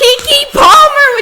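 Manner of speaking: high, drawn-out gleeful shout; excited shout. Real, not acted. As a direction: belt the name out like a thrilled fan. Excited shouting of a star's name.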